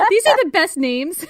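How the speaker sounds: high, loud, through laughter; laughing delight. Real, not acted. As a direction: crack up while saying it. Cracking up at invented names.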